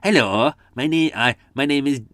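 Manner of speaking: hammed-up, bad Welsh accent; mock a crappy Welsh accent, loud and overdone; exaggerated fake Welsh singsong; awful Welsh accent. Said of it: "people do a really awful Welsh accent".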